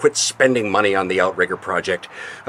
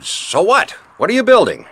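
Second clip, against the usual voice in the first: indignant; 9 semitones higher than usual. Acted. Husband cornered by detective.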